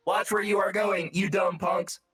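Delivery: put-on shouted old man rant; sputtering foiled villain. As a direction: yell it like an angry old man. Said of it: "in an oddly old man gesture, he, like, stands and shakes his fist".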